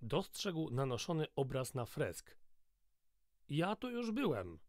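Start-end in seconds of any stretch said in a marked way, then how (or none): 3.5-4.6 put-on a high, dazed mumble from a waking drunk; a lightly voiced, confused young man, mumbling; mumbled. A narrator's reading. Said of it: "wymamrotał". (wysoko, zaspane mamrotanie)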